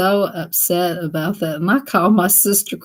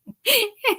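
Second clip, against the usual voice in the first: high laugh. Real, not acted. Cracks up at the bug joke.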